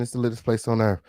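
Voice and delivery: ordinary talk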